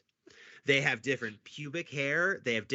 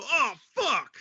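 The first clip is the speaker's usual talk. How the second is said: much higher startled curse; shocked curse; 14 semitones higher, 4 dB louder than usual. Retold or imitated. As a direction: blurt it out like you just saw the body. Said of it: "And I hear him go".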